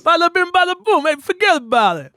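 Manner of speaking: loud, high, hammed-up New York Italian; broad mock New York accent. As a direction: shout it like a cartoon New York pizza seller. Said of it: "could you do a New York accent".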